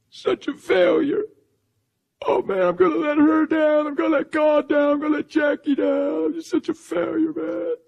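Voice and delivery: very high, wailing mock sob. Relapsed friend fears letting everyone down, imitated wailing. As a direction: imitate a distraught man blubbering through his words. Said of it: "he's tore up".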